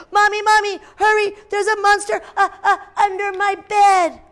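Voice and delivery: put-on high, urgent child's cry for Mommy; scared child calling. A narrator's reading. Child calling Mommy from bed, high and urgent. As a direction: call out like a frightened little kid.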